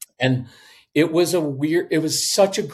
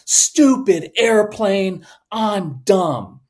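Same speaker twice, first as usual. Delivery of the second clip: put-on higher, louder, slow frustrated kid outburst; frustrated child; 6 semitones higher, 5 dB louder, much slower than usual. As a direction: act out the angry kid throwing his plane down. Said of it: "Strong-willed, intense child's going to crumple".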